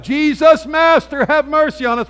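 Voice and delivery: high, loud plea; crying out. A narrator's reading. Lepers calling to Jesus for mercy, high and loud. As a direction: cry out to the Master for mercy. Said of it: "they cried out".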